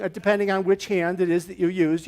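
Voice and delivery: ordinary delivery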